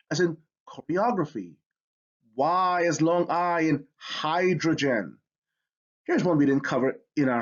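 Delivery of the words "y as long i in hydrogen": usual speaking voice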